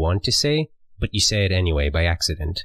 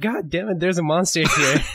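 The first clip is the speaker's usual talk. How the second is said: higher-pitched, exasperated mock startle; mock alarm; 8 semitones higher than usual. Dreamer finding a monster behind the curtain, mock alarm. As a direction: play up a comic jolt of alarm.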